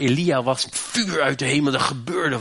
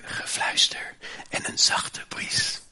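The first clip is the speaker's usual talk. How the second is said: whisper; 6 dB quieter than usual. A narrator's reading. Sermon retelling the gentle whisper.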